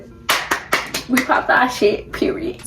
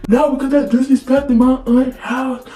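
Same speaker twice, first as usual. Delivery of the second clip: louder, higher phone scolding; mock ranting girlfriend; 4 semitones higher, 4 dB louder than usual.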